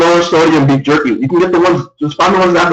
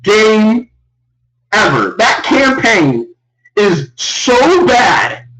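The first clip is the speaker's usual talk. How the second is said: slow emphatic verdict; 2 semitones higher, much slower than usual. Real, not acted. Repeats verdict slowly.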